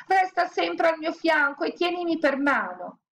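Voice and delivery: high, pleading command; cling with panic in the voice; frightened order. A narrator's reading. Scared woman ordering in the graveyard, high and anxious. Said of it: "ordinò la bambina".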